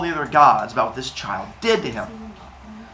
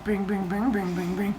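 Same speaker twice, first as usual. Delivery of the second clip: softer bing strumming as an answer; sheepishly pluck the lyre instead of answering; lightly sung lyre noise; 6 semitones higher, 5 dB quieter than usual. Retold or imitated. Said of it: "And Apollo's like".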